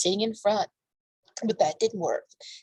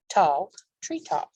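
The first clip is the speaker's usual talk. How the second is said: ordinary delivery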